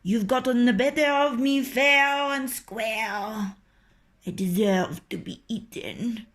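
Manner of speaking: butter up the fox; sly coaxing rooster. A narrator's reading. Rooster tempts the fox to taunt the farmer, sly.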